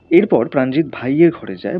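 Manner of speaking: ordinary delivery, nothing marked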